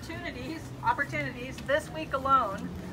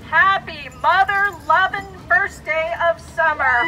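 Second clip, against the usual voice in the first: excited; 2 semitones higher, 9 dB louder, slower than usual. Real, not acted. Rallying the audience.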